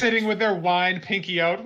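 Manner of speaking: normal talking voice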